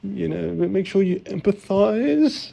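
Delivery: hammed-up shaky eager-to-please answer; nervous interview answer. Retelling his own shaky interview answer to his co-host, mock nervous and high.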